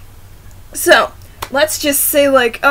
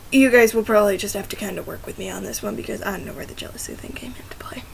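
rushed, then dropping to a whisper; fading to a whisper; 4 semitones lower, 9 dB quieter, faster than usual. Real, not acted. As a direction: mumble it off till it vanishes. Trailing off into whisper.

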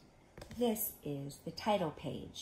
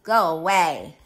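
loud, grouchy dismissal; gruffly shooing someone off; grouchy ladybug; 17 dB louder than usual. Acted. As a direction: bark it as a grumpy bug. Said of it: "And he's saying".

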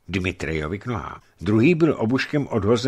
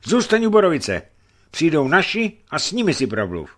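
high, loud cry to stay back; a frightened youth shouting a warning; shouted warning; 10 semitones higher, 7 dB louder than usual. Acted. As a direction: yell at him to keep away. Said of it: "vykřikl neznámý".

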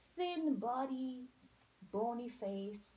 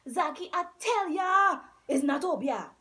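loud, higher defensive protest; 4 semitones higher, 12 dB louder than usual. A narrator's reading. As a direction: insist in defense. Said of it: "repeated in defense".

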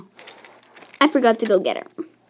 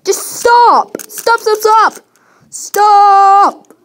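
shouted, higher and louder stops; yelling stop; 4 semitones higher, 5 dB louder, much slower than usual. Acted. Pet yelling at owner to stop, angry shout. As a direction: yell stop over and over at her. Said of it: "why'd you yell at me".

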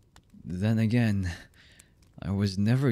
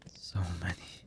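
very soft, near-whispered regret; hushed confession; 10 dB quieter than usual. Acted. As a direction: let it drop almost to a whisper. Confessing horrible things, voice drops away.

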